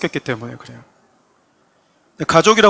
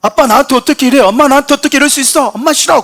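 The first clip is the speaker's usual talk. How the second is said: high, loud acted outburst at parents; child's angry protest; 9 semitones higher, 8 dB louder than usual. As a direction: shout it like an aggrieved child. 자녀가 부모에게 대드는 장면. (부모에게 대들듯 높고 큰 소리로)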